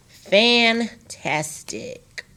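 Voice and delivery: fantastic said very slowly, fan by tas by tic; broken into syllables. Shown as a demonstration. Teacher showing students how to split fantastic slowly. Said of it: "see how I broke that up?".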